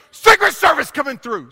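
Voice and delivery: loud, high announcement to clear the way; mock yell. Preacher pretending to push through a store line, mock yelling. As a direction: holler it over a crowd, comically overblown. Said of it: "Sorry, I said I wouldn't yell".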